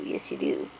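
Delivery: a drop to a low, soft rumble; low goofy pet voice. Real, not acted. Owner praising the rescue cat, dropping into a low silly voice. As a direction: answer for him in a deep silly voice.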